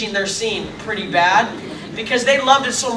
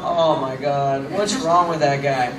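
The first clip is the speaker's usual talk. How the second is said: low, slow aside; dismayed muttering; 7 semitones lower, 3 dB quieter, slower than usual. Retold or imitated. Castmates shake their heads at the dancer, dismayed aside. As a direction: drop the voice low in weary disbelief. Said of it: "just kinda going".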